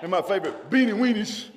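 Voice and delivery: soaring, sung whoop of a preacher; preaching at full force. Real, not acted. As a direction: let the sermon break into singing shouts. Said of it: "I done lost control".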